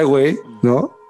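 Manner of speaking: ordinary delivery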